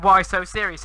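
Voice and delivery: ordinary talk, nothing marked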